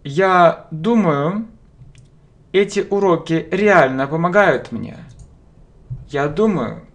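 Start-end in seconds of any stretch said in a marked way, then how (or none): none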